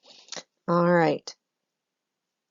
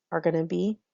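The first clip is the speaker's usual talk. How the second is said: usual speaking voice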